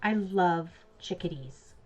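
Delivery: ordinary delivery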